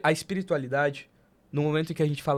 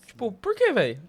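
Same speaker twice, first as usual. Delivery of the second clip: much higher, scoffing question; mock dismissive self; 8 semitones higher, 3 dB louder than usual. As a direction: toss it off like you don't care. Imita a si mesmo dispensando Deus. (imitando a si mesmo com desdém, bem agudo)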